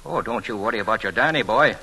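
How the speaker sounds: ordinary talk, nothing marked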